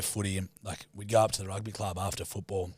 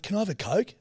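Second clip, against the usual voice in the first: put-on kid asking; 10 semitones higher than usual. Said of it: "I'm like".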